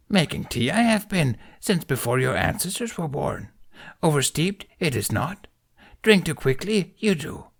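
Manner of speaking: put-on a wise old creature, gently scolding; Yoda voice. Yoda lecturing Ben on his tea, amused. Yoda's voice in story.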